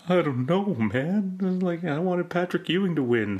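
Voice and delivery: high, whiny mock-tearful voice; retelling himself as a teen, crying after Ewing lost, high whiny voice; imitating teen crying. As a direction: play your younger self, lost and weepy. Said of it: "Why am I crying?".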